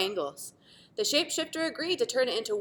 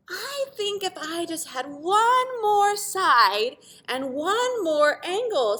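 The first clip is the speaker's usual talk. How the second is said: pentagon voice; 9 semitones higher, 5 dB louder, slower than usual. Acted. Pentagon asks for more.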